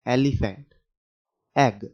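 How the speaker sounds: एलिफेंट and एग stressed loud on the opening e; e sound. Shown as a demonstration. Teacher drilling the e sound loudly for kids. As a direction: punch the e at the start of एलिफेंट and एग. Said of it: "कैसा आवाज आ रहा है".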